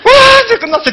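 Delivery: 훨씬 높은 목소리로 신나게 외치듯; 기쁨에 겨워 소리치듯. Retold or imitated. (혼자 기뻐서 높게 외치는 목소리)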